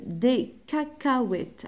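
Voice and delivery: "cacahuètes" drawn out, syllable by syllable; slow sounding-out. Shown as a demonstration. Teacher reading a word for pupils to find K sounds, slow sounding-out. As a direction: stretch the word out slowly for learners.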